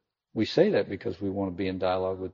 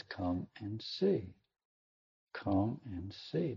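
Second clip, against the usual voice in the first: gentle invitation; 9 dB quieter, much slower than usual. Acted.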